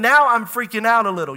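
normal talking voice

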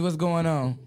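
usual speaking voice

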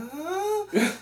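a sudden high squeak of confusion; a startled yelp, way up high; surprised huh. Retold or imitated. Hearing a strange voice at the gate, startled. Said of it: "You're like".

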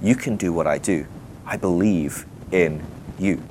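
low, soft, slowing vote of confidence; sincere assurance. Retold or imitated. Jesus tells a stranger face to face, low and sincere. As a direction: drop low and quiet, spacing out the last words. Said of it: "looks him in the eyes and says".

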